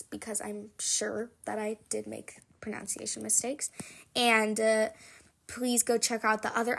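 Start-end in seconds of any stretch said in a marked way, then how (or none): 0.0-3.7 soft whisper, sheepish; whispered aside. Real, not acted. Author's closing aside.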